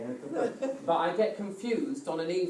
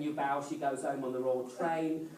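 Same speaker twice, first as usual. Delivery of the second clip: usual speaking voice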